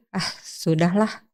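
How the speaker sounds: resigned. Acted. Acts out giving up in a queue.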